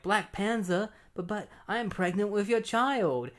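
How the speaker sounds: high, imitated soap-opera confession; high-pitched mock heroine voice; mock-melodramatic woman. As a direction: stammer it out as a hammed-up heroine. Superhero woman's confession, acted.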